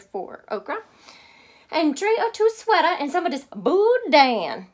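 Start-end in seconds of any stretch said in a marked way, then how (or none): 1.7-4.7 high, loud Cajun mama; Mama's voice. Acted. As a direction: call it out like a bossy Cajun mother. Mama instructs her daughter.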